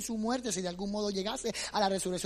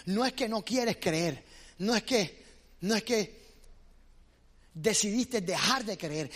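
usual speaking voice

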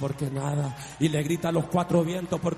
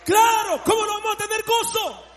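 high, loud, fervent testimony; shouting revival preacher; full-force preaching; 11 semitones higher, 8 dB louder than usual. Acted. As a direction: preach it out with fire to the congregation.

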